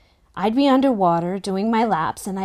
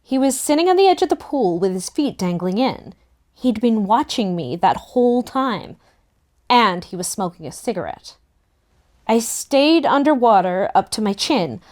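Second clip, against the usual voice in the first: usual speaking voice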